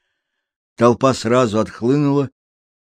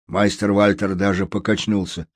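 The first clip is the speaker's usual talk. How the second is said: usual speaking voice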